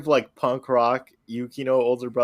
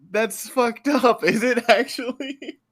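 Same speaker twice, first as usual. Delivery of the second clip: shocked; 9 semitones higher, 6 dB louder than usual. Real, not acted. Shocked at character reveal.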